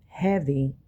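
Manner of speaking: ordinary talk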